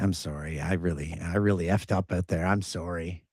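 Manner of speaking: people pleaser apology. Pretend. Pretend closer apologizing to each teammate, meek and soft. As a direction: mumble sorry like you've let everyone down. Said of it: "I'm such a people pleaser".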